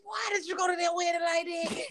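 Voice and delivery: high, wailing mock cry; hammed-up horrified whine; mock anguished wail. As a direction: cry it out as if scandalized. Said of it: "and it's like".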